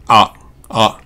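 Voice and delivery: あ open wide with a touch of 'o'; bright open 'ah' demonstration; bright 'a'. Shown as a demonstration. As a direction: say あ as the vowel of American hot. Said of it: "明るいあって言われてる".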